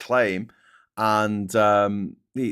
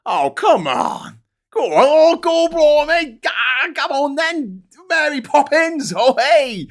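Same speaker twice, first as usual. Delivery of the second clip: very high, slow, hammed-up cockney; 9 dB louder, slower than usual. Mocking a bad movie cockney, hammed-up London accent. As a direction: overdo a terrible London accent, stretched out. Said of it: "his London accent was terrible".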